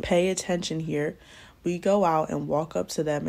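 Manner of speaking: ordinary delivery, nothing marked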